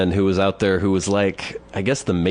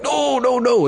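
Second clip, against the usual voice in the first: high-pitched mock agitation; agitated, high retold protest; agitated old man; slower than usual. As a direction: imitate him protesting, pitch shooting up. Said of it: "he starts getting agitated".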